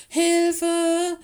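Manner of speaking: loud, high-pitched call for help; high, drawn-out panicked yell; cry for help. A narrator's reading. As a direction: shout it out desperately for rescue. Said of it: "rief Willis".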